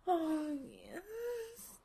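loud outcry. Acted. Partner crying out at the peak of the scene, loud high outcry. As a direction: let it burst out high and loud.

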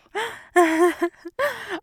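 high, loud predatory laugh; gloating monster laugh. Acted. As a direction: laugh like a beast savoring prey. Monster gloating over prey.

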